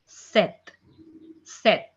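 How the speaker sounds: "set" as French seven, sounded "set" with no p; silent P. Shown as a demonstration. Teacher models French seven, silent P. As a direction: drop the p and end "set" on a crisp t. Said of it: "P will be silent here".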